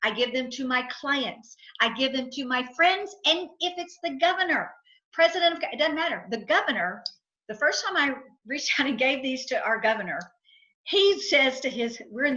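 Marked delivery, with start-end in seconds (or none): none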